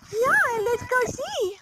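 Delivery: high, slow sing-song coo to the goose; high slow pet voice. Acted. Talking sweetly to pet goose.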